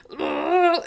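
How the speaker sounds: a high mock grunt of effort, biting cardboard; imitated grunt. Friend mimics the man's grunt to viewers, a high mock grunt. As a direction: grunt like a man biting through a box. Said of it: "And he's like".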